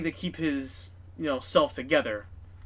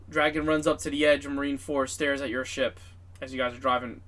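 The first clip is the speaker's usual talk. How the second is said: ordinary delivery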